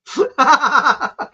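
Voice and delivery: high, loud burst of laughter; real hearty laugh. Real, not acted. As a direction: cackle at your own joke. Laughs at his own joke.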